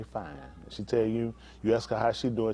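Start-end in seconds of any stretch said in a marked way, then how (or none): 0.0-0.4 soft demo. Shown as a demonstration. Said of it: "you gonna say".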